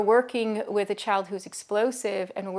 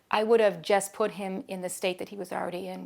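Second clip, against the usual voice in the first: ordinary talk, nothing marked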